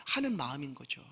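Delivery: normal talking voice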